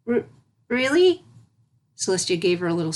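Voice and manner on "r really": high, stammering filly voice; frightened filly. A narrator's reading. Filly told she will learn to swim, frightened. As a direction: stutter it out, scared of the pool.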